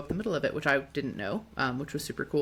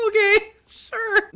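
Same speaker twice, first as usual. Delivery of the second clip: very high, loud, drawn-out okay; hammed-up high-pitched going along; acted uneasy agreement; 9 dB louder, much slower than usual. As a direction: agree slowly in a squeaky-high voice. Her acted hesitant agreement.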